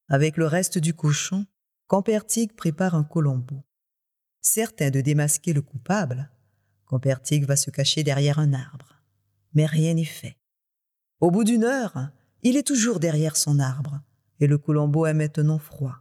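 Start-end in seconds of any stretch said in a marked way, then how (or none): none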